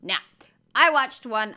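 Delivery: ordinary talk, nothing marked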